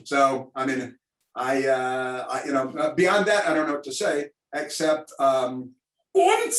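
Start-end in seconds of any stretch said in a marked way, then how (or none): 6.1-6.5 a high, shouted German "und"; mock German accent. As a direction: toss in a jokey German "und". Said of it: "we all grew up with the German accents".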